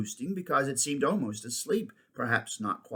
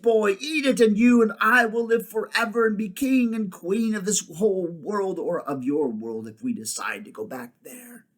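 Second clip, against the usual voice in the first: tempting witch, imperious; 5 semitones higher than usual. A narrator's reading. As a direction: tempt the boy with mounting urgency. Narrator voices the witch tempting.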